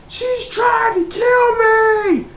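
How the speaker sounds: very high, slow, loud shriek of terror; terrified scream. Retold or imitated. Scared son screams to dad about his mom, shrieking terror. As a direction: scream it like your life depends on it. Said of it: "I go".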